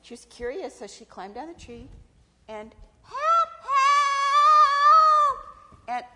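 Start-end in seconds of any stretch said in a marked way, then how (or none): 3.0-5.4 long, piercing high howl; crying for help. Acted. Trapped snake to whoever is near, howling for help. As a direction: wail loudly for rescue, stretching it out.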